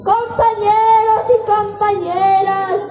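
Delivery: earnest school declamation; poem recitation. Acted. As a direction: recite it proudly to classmates.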